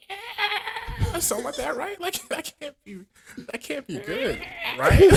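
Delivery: high, laughing through the words; real laughter taking over; laughing squeal. Real, not acted. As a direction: giggle it out high. Laughing at dying cat moans.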